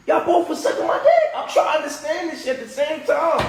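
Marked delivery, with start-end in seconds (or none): 0.1-1.3 high, loud, laughing in disbelief. Real, not acted. To his partners, laughing in disbelief. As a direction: laugh it out, can't believe it.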